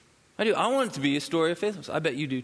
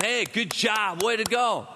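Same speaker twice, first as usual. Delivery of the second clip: mock cheer; 7 semitones higher, slower than usual. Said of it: "nobody's jumping out going".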